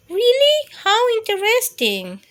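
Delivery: high squeaky good night; high mouse voice. Acted. Mouse saying good night to Bear, high squeaky voice. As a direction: chirp it up high and bright.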